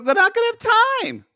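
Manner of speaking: loud, high-pitched objection; high-pitched protest. Real, not acted. Host objecting to co-host's recording plan, high-pitched protest. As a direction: protest with the voice shooting way up.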